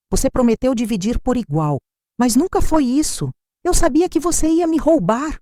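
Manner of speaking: high-pitched, accusing daughter; hurt, high-pitched reproach; angry accusation. A narrator's reading. Daughter's angry accusation voiced in story.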